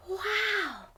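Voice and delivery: ordinary delivery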